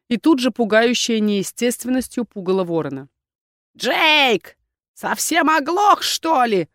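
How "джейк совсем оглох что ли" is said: high crone snarl; growling crone. A narrator's reading. Crone scolding Jake, high snarl. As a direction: snap at the deaf shopkeeper. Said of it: "рыкнула старуха".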